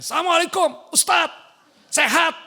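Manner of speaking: high, loud yelled greeting; mock barking shout. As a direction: imitate someone yelling down the phone. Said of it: "jangan bentak-bentak bu ya".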